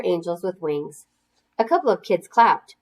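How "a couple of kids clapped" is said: plain reading voice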